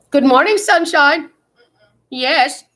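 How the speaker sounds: high, loud, slow cheery greeting; drawn-out wake-up call, beaming; sing-song good morning. Real, not acted. Mom greeting her just-woken kid in a loud, cheery sing-song.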